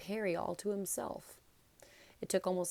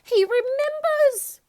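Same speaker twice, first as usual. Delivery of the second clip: very high, loud, slow squeal of joy; thrilled cry; 15 dB louder, slower than usual. A narrator's reading. Diggle cries out to everyone, thrilled Harry remembers, high and loud. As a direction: shriek it like he's won a prize. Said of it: "cried Daedalus Diggle".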